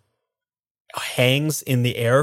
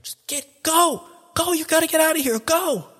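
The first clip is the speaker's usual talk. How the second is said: very high, strained small voice; thin, high, desperate urging; urgent plea to flee. Acted. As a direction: push them to escape while being dragged back. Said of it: "being like".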